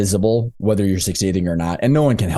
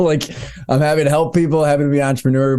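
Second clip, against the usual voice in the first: ordinary talk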